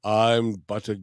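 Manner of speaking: lightly voiced lumbering brute; a hint of a dim big guy, slowed; quoting Mongo. Friend quoting Mongo's line, lightly voiced brute. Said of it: "when Mongo says".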